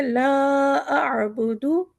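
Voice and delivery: very slow, drawn-out recitation; Quranic recitation. Shown as a demonstration. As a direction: chant each phrase slowly. Recites Surah Kafirun slowly. (धीमी तिलावत)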